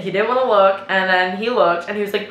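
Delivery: normal talking voice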